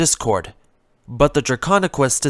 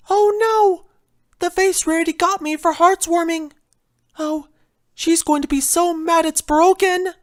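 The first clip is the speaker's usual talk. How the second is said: a timid pony fretting over a broken vase; Fluttershy's voice; 14 semitones higher than usual. Acted. Fluttershy frets to herself over the vase, higher and gentle.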